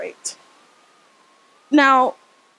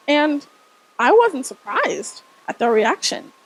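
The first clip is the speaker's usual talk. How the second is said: ordinary talk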